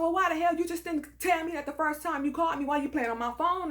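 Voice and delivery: high, indignant retold telling-off; going off. Woman scolding caller for not telling her sooner, high and indignant. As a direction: demand an answer, worked up. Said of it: "so I go off and I go".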